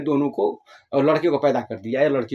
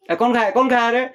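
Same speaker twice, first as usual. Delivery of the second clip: higher-pitched mock interrogation; questioning mother; 6 semitones higher, faster than usual. Mother asks the children who ate it, calling out. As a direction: call out to catch the culprit. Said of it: "अब वो mummy बोली कि".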